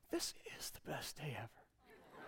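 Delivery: put-on breathy, hushed child's wonder; awed whisper. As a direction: whisper it like a boy in awe. Son in awe.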